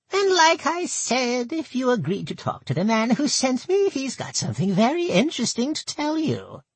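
put-on high, nasal old goblin woman; thick nasal goblin voice. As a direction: pinch it through the nose, goblin. Gertel speaks.

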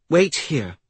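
put-on young heroine's voice. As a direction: call it out as the girl. Girl character speaks to wolf.

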